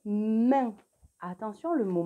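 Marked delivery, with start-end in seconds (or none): 0.1-0.8 "main" with a long held m, then the vowel; word sounded out. Shown as a demonstration. Teacher dictates a real word, "main" with a long m. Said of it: "Je voudrais que tu écrives le mot".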